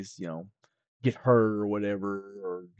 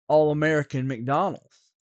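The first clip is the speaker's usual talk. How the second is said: ordinary delivery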